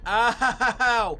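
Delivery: sharp yelp of pain; sudden ow from a burnt palm; burnt hand pain. Real, not acted. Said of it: "Sorry, I burned my hand last night".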